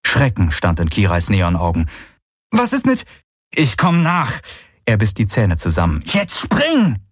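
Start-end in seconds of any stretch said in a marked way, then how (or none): 2.5-4.3 high, urgent exchange under fire; dringlicher Ruf. Acted. Hastiger Wortwechsel am Abgrund. 6.1-7.0 high, insistent shout; drängender Befehl. Acted. Wiederholter Befehl am Abgrund.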